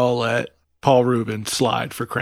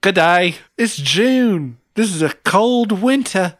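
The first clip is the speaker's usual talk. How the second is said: put-on Australian accent; 5 semitones higher, slower than usual. [name] ordering at an Australian diner, Australian accent. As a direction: ham up an Aussie ordering breakfast. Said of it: "What if you were from Australia?".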